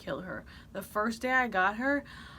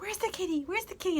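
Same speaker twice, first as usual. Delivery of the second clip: put-on kids' voice; 10 semitones higher, faster than usual.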